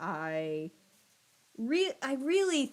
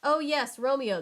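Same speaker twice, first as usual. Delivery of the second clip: nurse perks up; 5 semitones higher, 5 dB louder than usual. Acted. Nurse voiced in story.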